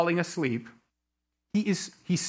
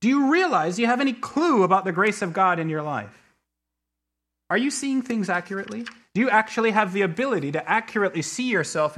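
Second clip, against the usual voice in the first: higher, urgent preacher's questioning; convicting inner voice; 4 semitones higher than usual. Retold or imitated. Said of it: "I was convicted of the moment of saying".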